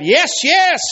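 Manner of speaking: high, loud shouted answer; acted demon shout. Demon voice answering the healer, shouting high. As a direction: shout it back high and forceful. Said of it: "this voice shouted".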